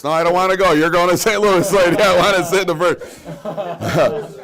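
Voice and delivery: high, loud hammed-up pushy passenger; loud, high comic bully; mock squabble. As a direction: shove the old lady off, big and silly. Mock squabble over seat.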